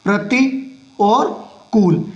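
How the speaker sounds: प्रति और कूल drawn out slowly, part by part; slow, split word. Shown as a demonstration. Shorthand teacher splits the word for students, very slow. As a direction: spell out प्रति and कूल slowly while writing. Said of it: "या फिर प्रतिकूल ऐसे लिख सकते हैं".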